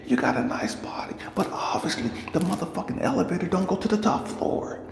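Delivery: high-pitched, indignant retold tirade; pissed, angry. Father confronting the woman who used his daughter, angry. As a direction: tear into them with pointed, incredulous questions. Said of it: "I'm pissed and I'm angry".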